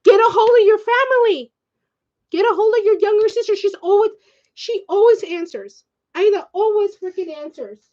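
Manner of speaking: high-pitched, frustrated insistence; exasperated urging. Real, not acted. Exasperated imagined advice to cousin.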